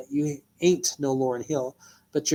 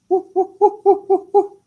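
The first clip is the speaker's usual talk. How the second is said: whooping up high in celebration; high woo cheer; 7 dB louder than usual. Real, not acted. Rapper finishing a diss with high whooping cheers. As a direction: cheer yourself on, high and loud.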